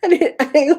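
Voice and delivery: ordinary delivery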